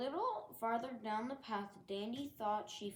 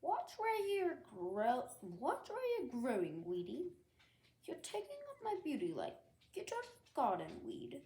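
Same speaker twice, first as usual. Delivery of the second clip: snooty, sharp high voice, rushing the insults; a proud rose shoving a weed aside; rude Rose; 4 semitones higher than usual. Acted. Rose rudely orders Dandelion out of the garden, snooty and sharp. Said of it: "Rose rudely pushed her aside".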